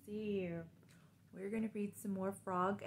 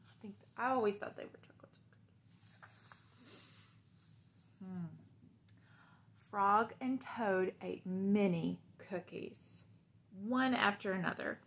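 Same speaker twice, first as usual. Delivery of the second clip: put-on reading to children; 5 dB louder than usual. Teacher reads Frog and Toad aloud to the class.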